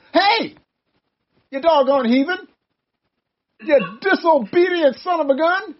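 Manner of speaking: high-pitched comic telling-off; hammed-up indignant rebuke; mock scolding. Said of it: "She could have said".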